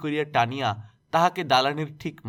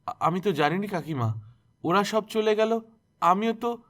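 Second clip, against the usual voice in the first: stammering in fear; 8 semitones higher than usual. A narrator's reading. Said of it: "অতি কষ্টে শুকনো জিভে জড়াইয়া উচ্চারণ করিল".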